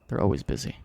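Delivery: ordinary talk, nothing marked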